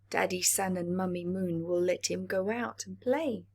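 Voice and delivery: ordinary delivery, nothing marked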